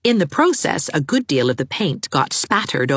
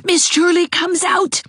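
urgent gasp; 3 dB louder than usual. Acted. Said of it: "gasped Geraldine".

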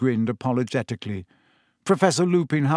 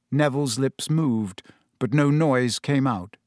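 usual speaking voice

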